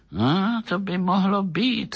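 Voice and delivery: high-pitched, considering agreement; agreeing. A narrator's reading. Police officer agreeing with the doctor's guess, conceding. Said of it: "souhlasil policejní úředník".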